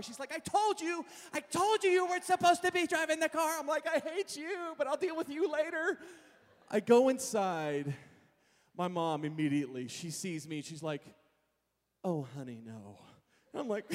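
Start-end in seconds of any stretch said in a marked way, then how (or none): 0.3-3.6 high, loud I-told-you-so; mock shrieky little sister; scolding little sister. Little sister scolds him again. 3.9-6.0 put-on high-pitched teenage retort; mock-angry brother; retold sibling anger. Brother snaps back at sister. 12.0-13.0 low, sinking mom voice; imitated disappointed mother; dismayed mom. Mom realizes what happened.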